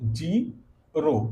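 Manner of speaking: Zero stretched very slowly and softly, final o held; zero को बहुत धीरे खींचकर; drawn-out demo. Shown as a demonstration. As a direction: draw out zero so the o is heard. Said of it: "यहाँ पर क्या है? ओ निकल रहा है।".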